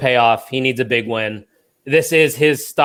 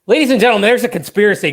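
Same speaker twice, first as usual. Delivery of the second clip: mock announcer; 8 semitones higher, 5 dB louder, much faster than usual. Mock announcer opening a conspiracy bit.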